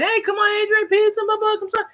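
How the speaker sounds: calling after him from the crowd; calling out to a player. Retold or imitated. Fans calling to player at practice.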